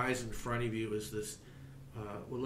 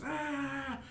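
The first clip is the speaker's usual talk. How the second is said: a high-pitched "Ah!" of the creature's pain; the giant cries out as it breaks; high scream. Acted. Giant figure screaming at the third gong strike. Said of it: "the figure goes".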